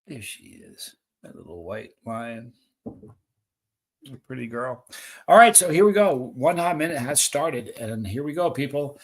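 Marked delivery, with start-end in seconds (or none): 0.0-4.8 hushed, much softer and lower pet talk, partly whispered; calm a frightened kitty gently; soothing the cat. Real, not acted. Host soothing the cat his shout scared, hushed and tender.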